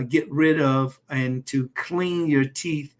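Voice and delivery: usual speaking voice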